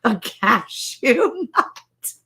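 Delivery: high, slow amazed cry; shocked disbelief. Real, not acted. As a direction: gasp out the disbelief. Shocked at cashew picture.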